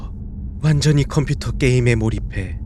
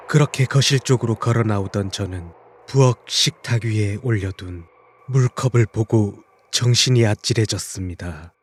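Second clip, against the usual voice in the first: plain reading voice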